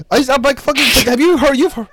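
high, loud cackling shout while laughing; laughter bursts into a shout. Real, not acted. Laughing loudly at a friend's joke, high shout.

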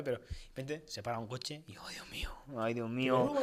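whisper. Retold or imitated. Retelling his fear aloud.

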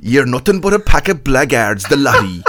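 put-on loud, indignant Kerry accent. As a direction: play an offended Kerry local in a Kerry accent. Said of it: "do his best Kerry accent".

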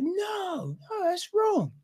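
put-on very high, squeaky little-kid protest; put-on kiddie voice; six-year-olds answering. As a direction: answer like an earnest small child. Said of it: "little fucking six-year-olds all sitting".

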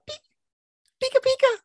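very high, slow squeaky cry; Pikachu voice. Acted. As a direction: squeal it way up like the mouse. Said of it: "It's really high pitched".

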